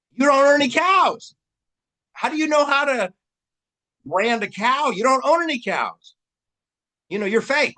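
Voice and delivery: high, loud sneering put-down voice; mocking disdain. Snob sneering at a fake cowboy, high mocking jeer.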